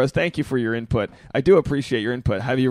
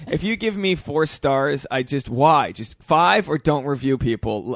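ordinary delivery

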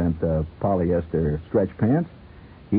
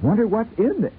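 higher, curious wondering; curious Sheila; 12 semitones higher than usual. Pretend. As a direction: eye the big box and wonder. Sheila eyeing her big package.

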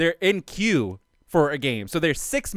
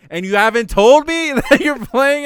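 loud, high, laughing mock anger; hammed-up aggrieved rant; mad complaint; 10 semitones higher, 10 dB louder than usual. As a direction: whine it like a hurt friend left out. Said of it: "so Tommy is mad".

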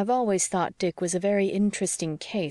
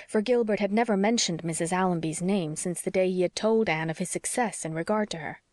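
ordinary delivery, nothing marked

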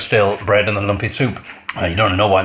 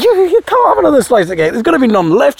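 much higher, put-on wife's voice, mock scolding; wife stopping Tom taking more cake, high mock scolding; 3 dB louder than usual. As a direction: squeak it like a fussy wife telling him off.

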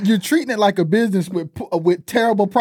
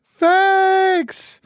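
high, drawn-out call of approval; heartfelt amen-style callout; emphatic agreement; 10 semitones higher than usual. Real, not acted. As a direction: stretch it out in approval. Co-host calls out agreement again.

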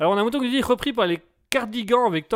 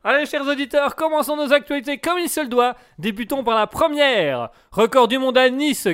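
radio host; 5 semitones higher, 5 dB louder than usual. Acted. Radio host announcing the news.

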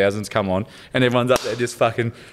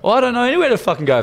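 high, fast, panicked; panicked outburst; 12 semitones higher, faster than usual. Retold or imitated. Recalling his panic in the shopping centre car park, high and fast. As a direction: blurt it out in a rush, much higher.

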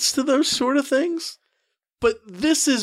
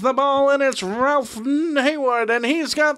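excited radio play-by-play, higher; play-by-play announcer; 5 semitones higher than usual. Pretend. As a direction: call it like an old-time radio announcer. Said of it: "run through it like a".

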